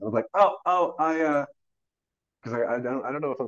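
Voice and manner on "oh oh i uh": higher, faltering attempt to cut in; acted-out hesitant "excuse me"; hesitant interjection. Actor trying to stop the departing director, hesitant.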